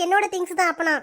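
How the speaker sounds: ordinary talk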